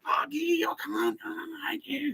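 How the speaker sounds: very high, strangled mock tantrum; mock hysterics. Ex-fling saying she hates him, mock hysterics. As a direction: squeal it softly like a faked meltdown. Imitates her hysterical outburst.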